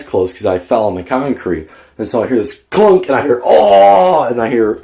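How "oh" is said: high, loud yelp of pain; pained cry. Retold or imitated. Little brother hit by the bat yelps high and loud.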